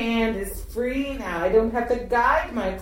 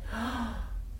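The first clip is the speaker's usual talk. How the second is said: gasp; 3 semitones higher, 13 dB quieter than usual. Acted. Mock surprise at discovery.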